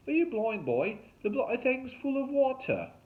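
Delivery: high, loud, scornful rant; exasperated fake Aussie. A narrator's reading. Derek scolds Wahoo over the flooded boat, exasperated. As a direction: berate him in a hammed-up Australian voice.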